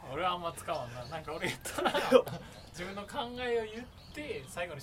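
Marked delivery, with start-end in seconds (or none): 2.0-2.7 loud short laugh; real giggle. Real, not acted. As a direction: break into a laugh. Laughs mid-sentence.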